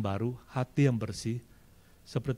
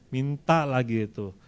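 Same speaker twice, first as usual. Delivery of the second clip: ordinary talk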